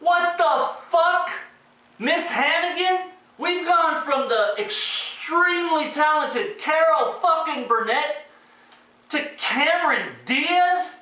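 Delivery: high, slow incredulous outburst; outraged disbelief. Real, not acted. Reviewer appalled at Miss Hannigan casting, high drawn-out disbelief.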